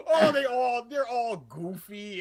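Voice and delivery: high, loud mock rant; exaggerated retold trashing; lambasting. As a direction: tear into it noisily. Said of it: "I just start lambasting One Piece".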